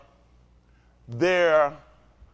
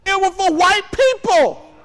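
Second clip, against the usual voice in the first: slow, louder, higher declaration; forceful; 11 semitones higher, 7 dB louder, slower than usual. Real, not acted. Lecturing students on the Constitution, loud and slow. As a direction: hammer the point home. Preaching to students, rising heat.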